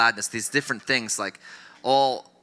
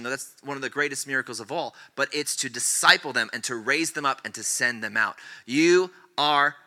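ordinary delivery

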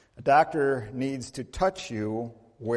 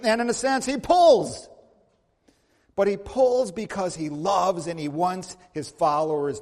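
higher, louder fervent sermon; preaching at full force; 7 semitones higher, 7 dB louder than usual. Acted. A pastor urges his congregation about healing, fervent loud preaching. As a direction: preach it with rising fervor.

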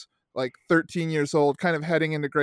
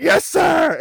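loud, high celebratory whoop; retold fist-pumping victory yell; mock triumphant shout; 11 semitones higher, 7 dB louder than usual. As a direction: imitate a kid crowing after a big hit. Imitates player celebrating with fist bump.

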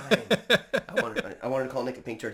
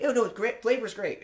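fake-cheery reassurance; 11 semitones higher, 4 dB louder than usual. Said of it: "And I was like".

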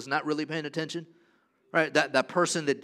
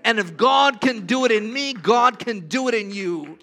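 loud, swelling preacher's proclamation; preaching at full force; 4 semitones higher, 9 dB louder than usual. Acted. Pastor declaring God's faithfulness to the congregation, loud and fervent. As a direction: lift the room with bold declarations.

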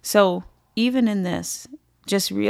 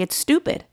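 "stupid" thrown out much higher; blunt, pitched-up "stupid"; said plainly; 9 semitones higher than usual. Real, not acted. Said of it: "if I could say it plainly".

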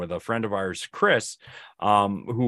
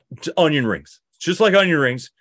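loud, higher Boston accent imitation; 5 semitones higher, 4 dB louder than usual. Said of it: "he just looks at me in a Boston accent".

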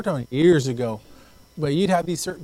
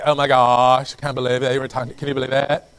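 a hint of gossipy mock-shocked girl slang; faddish girl talk; 3 semitones lower, 5 dB louder than usual. Girl gossiping about who talked to her, faddish girl talk. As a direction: lightly imitate a scandalized girl. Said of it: "girls talk like that back in the days".

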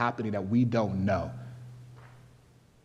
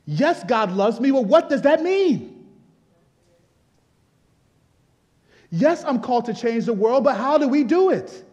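preaching at full force; 11 semitones higher, 8 dB louder than usual. Real, not acted. Pastor urging the congregation to be Bereans, preaching at full force. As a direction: drive it home like a pastor in full fire, much higher and louder. Sermon builds to a climax.

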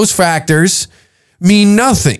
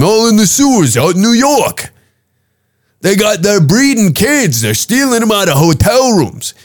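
higher-pitched mocking caricature; mock the conspiracy theorists in a put-on voice; condescending, mocking; 3 semitones higher than usual. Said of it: "in a condescending tone and, like, a mocking tone".

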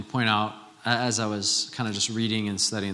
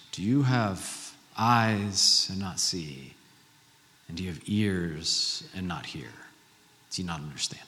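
hushed solemn questioning; 6 semitones lower, 6 dB quieter than usual. Acted. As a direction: let the question sink in softly, with long pauses. Solemnly voicing Jesus's question to disciples.

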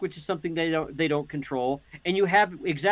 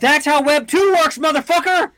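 high furious yell; shouting; 10 semitones higher, 9 dB louder than usual. Real, not acted. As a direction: yell it at him. Heated argument, yelled insult.

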